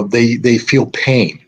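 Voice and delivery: ordinary talk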